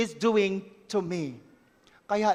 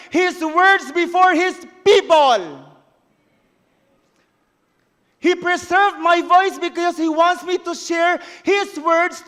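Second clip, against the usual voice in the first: high, loud preaching declaration; a judge testifying, loud and high to the congregation; preaching at full force; 7 semitones higher, 8 dB louder than usual. Acted. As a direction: proclaim it to the whole congregation. Preaching climax on God's gift.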